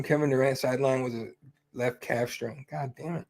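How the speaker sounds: usual speaking voice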